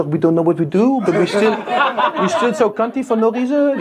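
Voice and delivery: high, mocking French accent; goofy French accent. Comic playing shrugging French people, goofy high French accent. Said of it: "I think French sounds so goofy".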